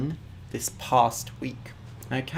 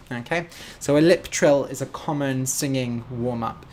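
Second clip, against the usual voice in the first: usual speaking voice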